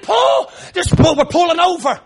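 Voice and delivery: angry order. Retold or imitated. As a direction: holler the order as an acted outburst. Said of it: "I'm angry now".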